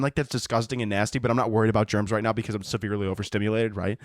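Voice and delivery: ordinary delivery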